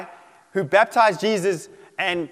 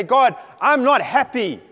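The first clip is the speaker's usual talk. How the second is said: high, loud, drawn-out protest to God; acted indignant outburst; angry complaint; 7 semitones higher, 6 dB louder, slower than usual. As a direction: shout it upward, each word leaned on. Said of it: "take your anger and say".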